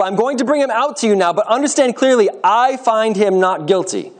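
high, insistent public announcement; emphatic declaration. A narrator's reading. Pilate announces his finding to the people, emphatic declaration. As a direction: make the verdict unmistakable to the crowd. Pilate addresses the crowd in story.

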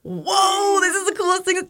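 high, drawn-out whoa; excited fangirling. Retold or imitated. Skier retelling being recognized by a fan, excited. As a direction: gush in a sustained high voice.

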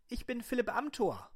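high-pitched put-on voice; high silly voice. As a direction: squeak the name out. Character introduces himself.

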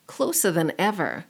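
ordinary delivery

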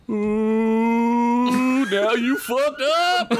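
high, drawn-out mocking troll call; a troll taunting from the back; yelling troll. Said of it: "A troll in the background yelled".

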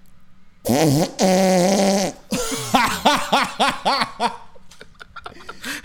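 loud raspberry noise breaking into laughter; imitated loud fart, then laughing. Host imitating his sleeping friend's noise, loud and laughing. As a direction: mimic the blast, then crack up. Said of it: "he goes like this".

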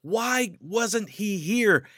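slow, high, anguished WHY falling at the end; angry demand. Retold or imitated. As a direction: drag out the word why in angry grief. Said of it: "She was angry with Jesus".

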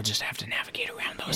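hushed careful whisper. Acted. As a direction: tiptoe through it in a whisper. Tiptoeing past ivory.

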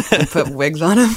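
laughing through the words; real laughter. Real, not acted. Joking about the troll farm, laughing. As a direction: crack up at your own joke. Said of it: "I'm trying not to laugh".

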